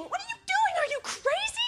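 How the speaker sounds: very high, loud outraged shriek; screaming. Acted. Girls back from the store to the car rammer, screaming. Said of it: "and they're screaming".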